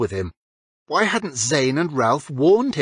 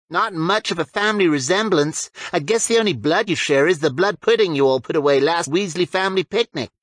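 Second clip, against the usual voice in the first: a slightly higher, teasing schoolboy voice; lightly voiced Zane; 6 semitones higher than usual. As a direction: tease your friend a bit higher than usual. Zane teases James again.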